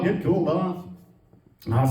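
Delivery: ordinary talk, nothing marked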